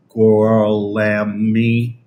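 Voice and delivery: "Gorlami" drawn out very slowly, sounded out; unsure pronunciation. Real, not acted. Streamer reading a viewer's name, slowly sounding out "Gorlami". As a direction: stretch "Gorlami" slowly, testing it. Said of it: "I don't know how to say his name".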